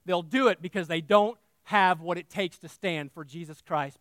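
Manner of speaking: ordinary talk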